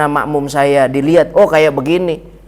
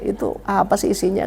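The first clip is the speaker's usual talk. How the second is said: hushed, half-whispered inner question; a curious whisper to yourself; 8 dB quieter than usual. Retold or imitated. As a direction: mutter it as if only thinking it. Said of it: "dalam hati nanya itu".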